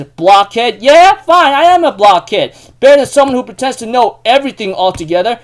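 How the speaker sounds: loud, high, defiant mock outburst; acted heated comeback. Trading insults while grabbing shirts, acted heated defiance. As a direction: own the insult and throw it back. Retold fight, insults thrown back.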